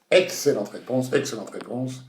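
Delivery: ordinary talk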